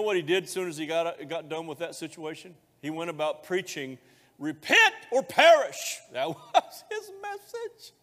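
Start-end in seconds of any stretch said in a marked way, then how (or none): 4.4-6.0 high, loud, slow cry of repent or perish; mock preacher shout. Pastor imitating Jesus' message, slow loud shout. 6.1-7.9 laughing. Real, not acted. Laughs at own quote.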